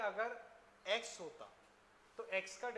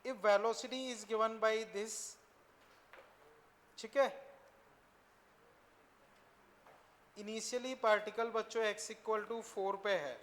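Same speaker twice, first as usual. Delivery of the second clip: loud slow dictation; 6 dB louder, slower than usual. Real, not acted. Teacher dictates question to class.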